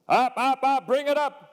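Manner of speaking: high, loud alarmed yelling; urgent shout. Retold or imitated. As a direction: yell it like the plane is about to hit. Instructor panics, plane too low.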